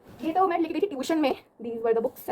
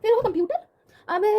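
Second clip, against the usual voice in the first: much higher, rising on फिर; puzzled double-take; startled rising re-read; surprised question; 8 semitones higher, 3 dB louder than usual. Real, not acted. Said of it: "हैं?".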